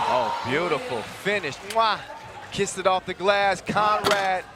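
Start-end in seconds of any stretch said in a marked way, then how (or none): 1.7-2.0 chef's-kiss "mwah"; kiss sound. Acted. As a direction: smack an appreciative kiss noise. Imitated kiss for a beautiful finish.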